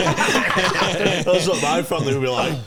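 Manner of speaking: laughing. Real, not acted. Laughing while joking about family.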